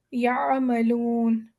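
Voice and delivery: یعملون slow, soft throaty ayn with no catch. Shown as a demonstration. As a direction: ease یعملون out of the throat gently. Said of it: "ہم بہت softly نکالیں گے".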